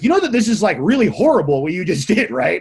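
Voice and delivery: loud, high indignant telling-off; retold yelling. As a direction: act out laying into the boss. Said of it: "I just started yelling at her".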